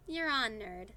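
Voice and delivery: loud playful taunt; cocky acceptance. A narrator's reading. Kelly accepting Eli's bet, loud cocky tease. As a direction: throw down the challenge, teasing.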